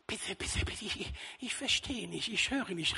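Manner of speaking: soft, breathy pretend-deaf plea; the sacristan pretends not to hear the priest; feigning deafness. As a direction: play dumb as if you can't hear. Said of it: "können Sie bitte ein bisschen lauter reden".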